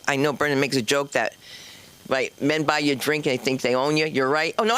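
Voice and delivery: ordinary talk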